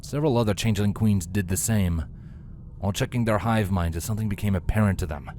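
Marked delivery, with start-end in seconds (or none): none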